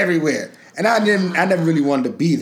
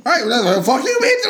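high, loud hammed-up shout; mock spurned man; 8 semitones higher, 3 dB louder than usual. A man acting out yelling at a woman who turned him down. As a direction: bark it back like a rejected guy.

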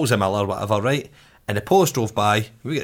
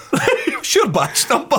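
slow, laughing mock demand; high, laughing retold demand; laughing through the quote; 11 semitones higher, much slower than usual. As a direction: ask it while cracking up. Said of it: "And Paddy goes".

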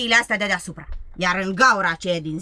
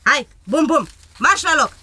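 louder, higher order; bossy command; 4 semitones higher, 6 dB louder than usual. Acted. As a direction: send the animal back firmly. Ordering the reindeer back. (poruncă tare către ren)

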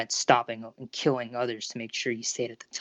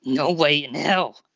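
slow, louder, defiant growl; inward growl; 3 semitones higher, 5 dB louder, much slower than usual. A narrator's reading. Boy bracing the door against his classmate, growling in his mind. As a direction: growl the refusal slowly and firmly. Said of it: "Deku growled in his mind".

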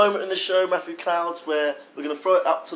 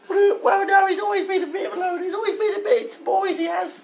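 high, put-on comic mum voice; Anne. Mother phoning the show about Dave, high falsetto. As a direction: play the caller's mum in falsetto. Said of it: "It's Dave's mum".

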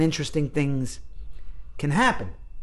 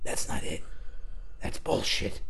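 whispered verdict; hushed dismissal; stern acting teacher; 11 dB quieter than usual. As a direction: imitate the coach cutting you down in a whisper. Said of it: "if you went to your head and tried to manipulate it".

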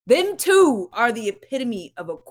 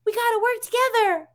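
mock teacher voice; 11 semitones higher than usual. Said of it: "they're over there teaching".

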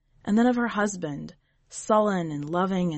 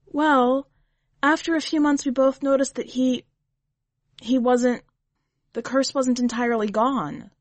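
put-on higher, louder hesitant young wife; Leah, hesitant confession; 5 semitones higher, 4 dB louder than usual. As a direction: stumble into the painful truth, voice lifted. Narrator voices Leah confessing.

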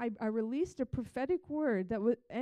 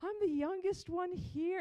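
high, slow, pitiful self-pity voice; milk the sympathy in a high slow whine; a mock-tearful young woman pleading for pity; whiny sob story; 8 semitones higher, slower than usual. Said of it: "release all this sob story".

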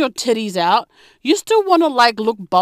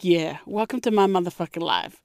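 softer, lower, knowing welcome; quiet knowing; 6 semitones lower, 7 dB quieter than usual. Retold or imitated. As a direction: say it low, like a shared secret. Knowing look to friend.

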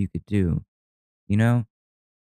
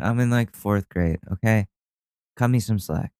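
ordinary talk, nothing marked

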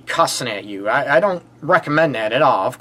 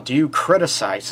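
normal talking voice

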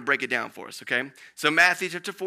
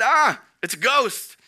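terrified cry; 7 semitones higher, 5 dB louder than usual. A narrator's reading. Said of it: "they were terrified and said".

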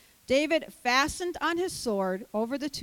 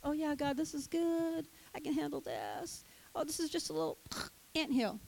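complacent murmur; 2 semitones higher, 7 dB quieter than usual. Retold or imitated. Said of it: "How many times have we dealt".

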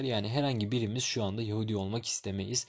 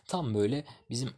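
normal talking voice

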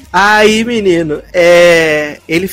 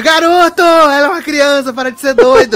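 mock scolding; 8 semitones higher than usual. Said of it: "E ele, [name]".

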